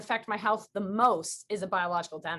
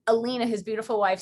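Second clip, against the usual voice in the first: normal talking voice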